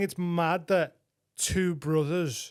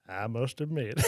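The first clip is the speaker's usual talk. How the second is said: low, soft narrator voice, a bit southern; half-hearted Morgan Freeman take; Morgan Freeman impression; 6 semitones lower, 5 dB quieter than usual. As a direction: try for a deep film narrator. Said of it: "Let me get into character".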